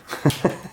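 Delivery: breathy wheezing laugh; wheezy laugh. Real, not acted. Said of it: "I sound like a wheezy dog".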